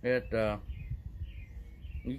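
speaking with pipe clenched; words through pipe-held lips; talking around a pipe stem. Real, not acted. Seen 3: Pipe stem between lips 0.1–2.1 s, removed by 2.3 s.

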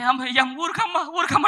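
high, soft mock sobs; small high-pitched put-on cry; imitated sobbing mourner. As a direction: act out tearful hushing between sniffles. Mourner being hushed, acted out.

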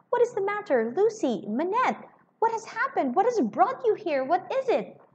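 Lorry pressing Lucy, breathless and confused; breathless confused questions. A narrator's reading. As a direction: pour out the questions, flustered and breathless. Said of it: "breathless and confused".

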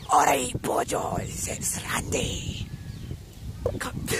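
possessed, slow, unvoiced ghostly rasp; very low, drawn-out haunted voice; Randy. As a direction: croak it out slowly like a spirit taking over. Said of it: "my body and he'll be like".

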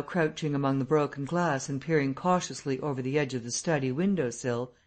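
ordinary delivery, nothing marked

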